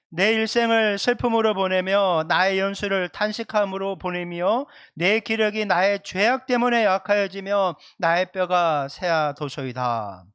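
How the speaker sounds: loud, measured responsive reading, slowing to the close; scripture reading. Real, not acted. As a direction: read the verse out for all to follow. Reads scripture aloud with congregation. (또박또박 큰 소리로 성경 봉독)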